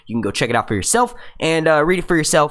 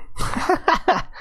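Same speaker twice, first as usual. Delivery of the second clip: high, breathless giggle; laughing. Real, not acted. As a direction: giggle at your own slip. Laughs at own slip.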